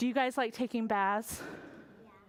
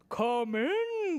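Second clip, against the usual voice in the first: king; 2 semitones higher than usual. Acted. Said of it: "cried the king".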